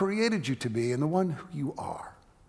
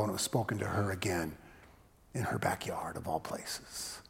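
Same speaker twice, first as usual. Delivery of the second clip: hushed; 7 semitones lower, 9 dB quieter than usual. Acted. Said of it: "sermon climax, hushed".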